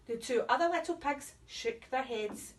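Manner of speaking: usual speaking voice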